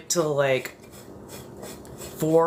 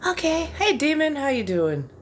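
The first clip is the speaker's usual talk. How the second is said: bright greeting; 12 semitones higher than usual. Real, not acted.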